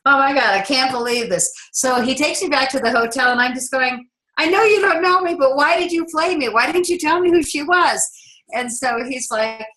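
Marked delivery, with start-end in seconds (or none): 4.4-8.0 high-pitched, indignant retold complaint; acted-out exasperation, pitched well up; exasperated protest. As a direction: demand an explanation in a raised pitch. Retells confronting man for not telling.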